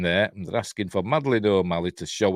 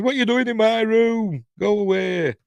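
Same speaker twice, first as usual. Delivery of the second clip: put-on grumpy drunk; 5 dB louder than usual. Drunk woman woken, annoyed.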